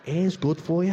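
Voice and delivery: low coaxing tempter; low sly voice selling air as food; inner craving voice. Acted. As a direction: wheedle him into the sweets, low and persuasive. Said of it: "He's like".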